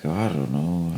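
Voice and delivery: low, soft half-asleep mumble; sleepy mumble. Retold or imitated. Drunk Santa in the bathtub, barely awake, mumbling. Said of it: "he's barely awake".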